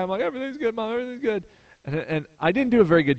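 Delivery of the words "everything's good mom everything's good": high, strained reassurance to Mom; imitated holding back tears. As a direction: mimic putting on a brave voice, pitched way up. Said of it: "so I'm trying not to cry".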